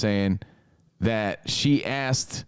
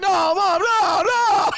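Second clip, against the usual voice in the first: much higher, louder desperate whine; hammed-up mortified boy; whiny kid; 5 dB louder, slower than usual. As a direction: beg and protest like a mortified teen. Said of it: "And he's like".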